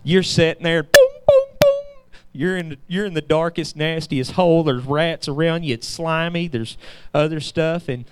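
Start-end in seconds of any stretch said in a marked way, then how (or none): 0.8-2.1 high, loud booming sound effect; imitated crashing booms; mock booms. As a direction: bang out the booms like doors bursting open. Imitates the doors bursting.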